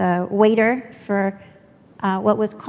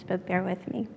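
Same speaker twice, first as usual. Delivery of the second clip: normal talking voice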